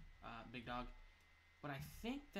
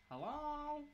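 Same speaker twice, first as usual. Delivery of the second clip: calling out; 13 semitones higher than usual. Real, not acted. Calling out in a hallway.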